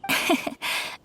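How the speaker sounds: a short breathy polite laugh. Acted. Noblewoman laughing at the merchant's worry, soft polite laugh. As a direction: laugh it off lightly, amused. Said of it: "Very humorous".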